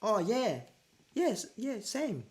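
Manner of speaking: high, mock-awkward agreement; awkward girlfriend. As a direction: squeak out a flustered yes. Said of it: "You're like".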